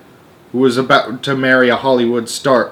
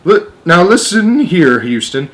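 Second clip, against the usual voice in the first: urgent; 4 semitones higher, 4 dB louder than usual. Acted. Astronaut reports strange people.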